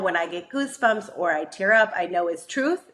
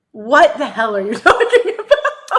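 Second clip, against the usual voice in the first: high, loud, laughing disbelief; acted incredulity; 6 semitones higher, 6 dB louder than usual. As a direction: replay baffled protest through a laugh. Retold inner disbelief, laughing.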